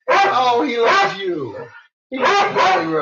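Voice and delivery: high, sing-song cooing at a dog; pet talk. Real, not acted. Owner coos to her dog about being loved, high and sing-song. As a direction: coo it to the puppy.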